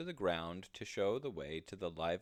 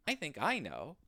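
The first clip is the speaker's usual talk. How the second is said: wise squirrel; 8 semitones higher, 3 dB louder than usual. Acted. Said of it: "said the wise squirrel".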